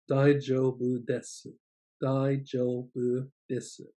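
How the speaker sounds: "Daijoubu desu" said slowly, syllable by syllable; clear, slow teacher demo; slow model phrase. Shown as a demonstration. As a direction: draw out "Daijoubu desu" for learners. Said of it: "say these others slowly too".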